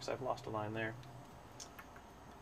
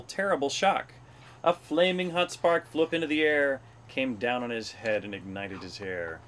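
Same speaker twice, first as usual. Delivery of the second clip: animated rhyming read-aloud, rising higher and louder at dramatic moments; story narration; 4 semitones higher, 4 dB louder than usual. A narrator's reading. Reader recites Hubert the Lion's tale, animated story narration. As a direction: read a rhyming picture book with bounce.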